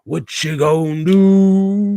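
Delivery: put-on slow, drawn-out old-time character voice; tap dance man impression. Streamer imitating the Them character for his co-host, slow and drawn out. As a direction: stretch each word like the creepy tap dancer. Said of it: "That was a good accent there".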